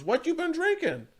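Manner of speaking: lightly voiced old man on a train; old man's friendly question. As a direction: ask it gently, like a grandfather. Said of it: "the old man sitting down goes".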